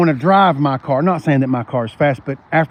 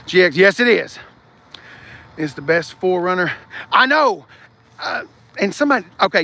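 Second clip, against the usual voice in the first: normal talking voice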